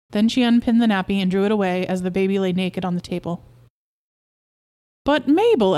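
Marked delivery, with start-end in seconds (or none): 5.0-5.8 high, loud protest; excited cry. A narrator's reading. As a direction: cry out to win her over. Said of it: "Albert cried".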